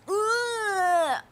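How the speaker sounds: high, drawn-out "ugh"; disgusted groan. Real, not acted. Viewer fed up with her bragging, long disgusted groan.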